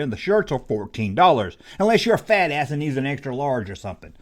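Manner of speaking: ordinary delivery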